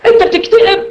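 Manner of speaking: صوت أعلى بكثير باستغراب ساخر؛ اندهاش مسرحي متهكم؛ سائل ساخر يستغرب اكتئاب بولس بصوت عالٍ; mock disbelief.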